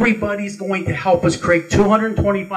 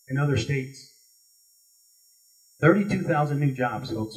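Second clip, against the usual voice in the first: ordinary talk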